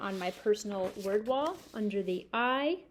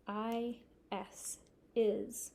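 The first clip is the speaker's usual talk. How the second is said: slow spelling; 4 dB quieter, much slower than usual. Shown as a demonstration. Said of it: "spelling while writing".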